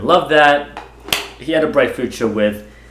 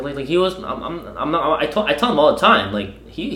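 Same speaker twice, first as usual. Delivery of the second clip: ordinary talk, nothing marked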